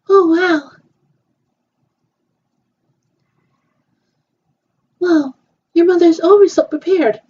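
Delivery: high, bright-eyed play character; a doll chatting after check-in; girly doll voice. Pretend. Voicing toy girls at hotel.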